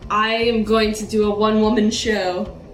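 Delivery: ordinary talk, nothing marked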